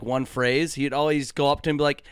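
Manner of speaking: ordinary talk, nothing marked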